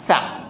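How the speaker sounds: স্যামন said short, "SAM'n"; correct pronunciation. Shown as a demonstration. Teacher repeats the fish's name for learners, correct pronunciation of স্যামন. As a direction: swallow the last vowel of স্যামন. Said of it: "এটা একটু কম শোনা যাবে".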